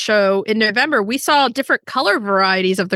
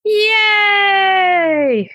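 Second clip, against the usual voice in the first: cheering; 10 semitones higher, 4 dB louder than usual. Real, not acted. Cheering after show plug.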